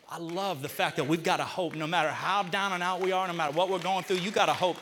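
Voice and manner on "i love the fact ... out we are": ordinary talk, nothing marked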